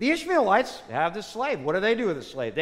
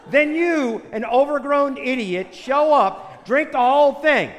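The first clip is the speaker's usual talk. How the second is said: higher, louder furious rant at the biker; angry outburst; 7 semitones higher, 7 dB louder than usual. Acted. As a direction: blast the idiot who drank the poison. Despairing man rails at biker.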